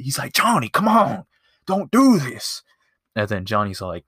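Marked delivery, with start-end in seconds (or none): 0.3-2.6 high, loud frantic plea; losing it. Retold or imitated. Stunt coordinator begging Johnny not to jump, frantic. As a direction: beg him not to, voice pitched way up. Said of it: "loses his shit".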